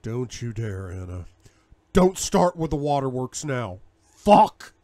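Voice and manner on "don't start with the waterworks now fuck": louder angry outburst ending in a yelled curse; angry snap. Acted. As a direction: cut her tears off. Snaps at crying Anna.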